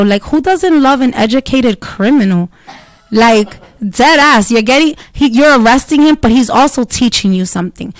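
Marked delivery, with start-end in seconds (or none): none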